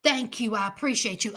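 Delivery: tender, grateful pretend apology; sweet apology. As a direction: coax Mama with thanks and a little money. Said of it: "You can say".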